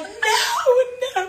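mock scream of refusal; retold scream. Telling friends about the accident, retold scream. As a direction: wail the no in horror, half-hearted. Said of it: "I'm screaming".

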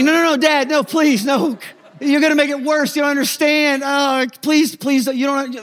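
put-on high-pitched whiny kid begging; panicked pleading. Kid begging dad not to go.

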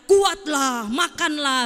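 loud mock voice of God; commanding divine voice. God appears in the room to the weak, loud acted command. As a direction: proclaim it as a booming command.

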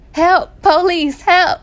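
slow, high, loud hammed-up call for police through laughter; mock cry for help. Joking plea while laughing.

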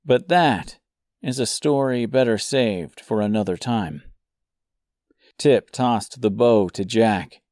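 plain reading voice

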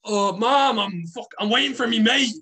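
high, loud mock whine to mom; imitated drunken protest. Acts out his drunken excuse.

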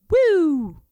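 high, loud siren-like woo; loud rising siren woo; siren whoop. Retold or imitated. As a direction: imitate the police siren. Siren lights come on.

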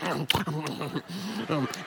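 mock disgusted huff; disgusted groan. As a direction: groan quietly to show you're disgusted. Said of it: "So I just went".

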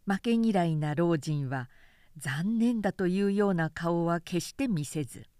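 plain reading voice

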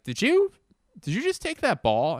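high, startled did you; surprised question. Retold or imitated. Speaker asks the homeless man about the stolen ball, high and startled. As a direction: catch him in the act, disbelieving. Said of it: "and I was like".

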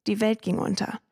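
usual speaking voice

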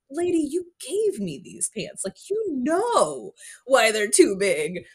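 high, slow exasperated protest; indignant retort. Retold or imitated. Cadet thinking back at her sergeant, high and exasperated. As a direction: spell out the obvious, exasperated. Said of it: "my first reaction is like".

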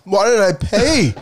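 loud, very high wailing; pained wail. Real, not acted. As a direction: wail in regret. Regretting the hot chip.

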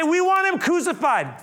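high, insistent crowd cry; mob demand. Retold or imitated. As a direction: imitate a crowd clamoring for blood. Mob shouts its demand back.

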